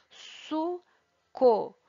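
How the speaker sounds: "su, co" as two separate beats with a pause between; split into syllables. Shown as a demonstration. Teacher showing pupils how syllables sound, split into syllables. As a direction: say su, then co, each syllable drawn out alone. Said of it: "separar as sílabas por esse som".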